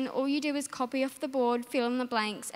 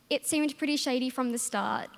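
usual speaking voice

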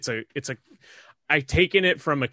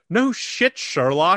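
sarcastic; 7 semitones higher, 3 dB louder, much slower than usual. Acted. Sarcastic retort.

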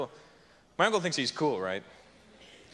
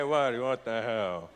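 Italian gesture voice; 5 semitones lower, much slower than usual. Retold or imitated. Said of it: "In Italian, they always doing this".

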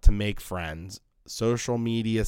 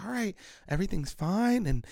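high, softer voice of a picky person; prissy mock precision, pitched up; meticulous fusspot; 5 semitones higher, 3 dB quieter than usual. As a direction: mimic someone fussing over every tiny detail. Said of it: "these people are just so meticulous".

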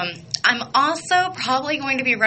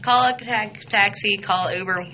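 normal talking voice

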